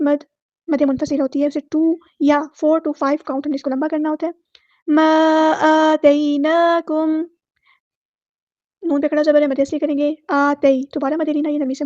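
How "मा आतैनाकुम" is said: slow, drawn-out recitation; slow Quranic recitation. Shown as a demonstration. Teacher reciting verse words slowly for students. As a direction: stretch every vowel as in tajweed.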